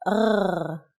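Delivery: R rolled in the throat like gargling; a drawn-out guttural R, as in French; throaty rolled R. Shown as a demonstration. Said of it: "make the sound from the throat".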